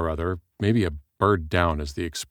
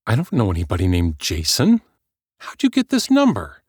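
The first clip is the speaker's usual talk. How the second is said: rising higher and louder into the question; suspicious anger; 3 semitones higher, 5 dB louder than usual. Acted. Heather grilling the caller about her number, angry. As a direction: demand it, rattled and hostile.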